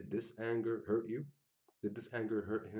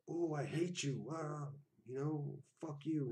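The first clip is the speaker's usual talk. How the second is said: mock hurt yelling; 7 semitones higher, slower than usual. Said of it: "just yelling and cussing".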